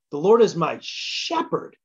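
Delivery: slow build to a punched SHEPHERD; stress on SHEPHERD. Shown as a demonstration. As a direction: save the weight for "shepherd". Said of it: "putting emphasis on different words".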